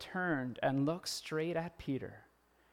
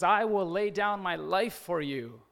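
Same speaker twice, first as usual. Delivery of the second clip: loud, slow, raised-pitch declaration; bold vow; 4 semitones higher, 7 dB louder than usual. Acted. Peter vowing devotion to Jesus, bold and loud. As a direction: proclaim it with full conviction.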